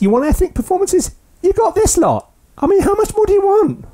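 high-pitched comic exasperation; mock indignant rant. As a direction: rant at demanding viewers in a silly high voice. Mock rant at demanding audience.

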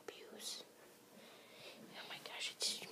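whispering near a wild animal; very soft, barely voiced whisper; hushed whisper. Vlogger creeping up on a wild animal, whispering.

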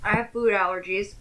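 talking behind hand; chewing while talking. Real, not acted. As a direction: mouth partly full. Seen 1: Bites burger just before, covers mouth chewing early in words.

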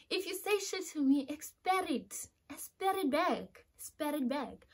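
high, slow and deliberate; defiant warning. Real, not acted. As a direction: lay down a warning, stretching each phrase. Warning about answering back.

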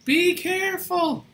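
high, slow, drawn-out warning; exaggerated warning. Real, not acted. Host warning about the pillow, high drawn-out plea.

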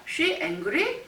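slowed, with angry rising high at the end; rising question tune. Shown as a demonstration. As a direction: drop is and lift angry. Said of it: "is를 생략하고".

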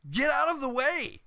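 high yelled warning to a teammate; mock yell. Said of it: "the guy's probably screaming at you".

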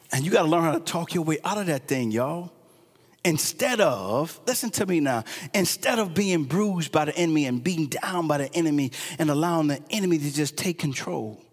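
hushed sermon close. Acted. Preacher quietly pleading with the congregation, hushed. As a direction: bring it down low and confide it.

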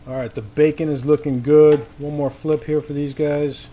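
usual speaking voice

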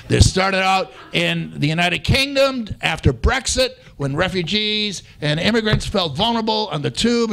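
usual speaking voice

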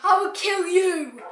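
villain voice. Acted. Mock villain threat.

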